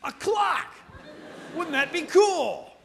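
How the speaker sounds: high, loud burst of excitement, slowing at the end; excited idea. Retold or imitated. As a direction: shout the idea like a kid who just had it. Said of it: "I said to myself roughly".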